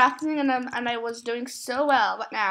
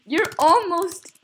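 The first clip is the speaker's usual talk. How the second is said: excited disbelief; 4 semitones higher, 4 dB louder, slower than usual. Real, not acted. Vlogger calling out her friend about subscribers, amazed.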